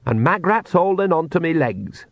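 calmly chatty in a crisis; conversational Nanny Ogg. A narrator's reading. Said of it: "said Nanny Ogg conversationally".

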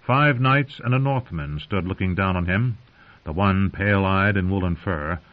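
ordinary delivery

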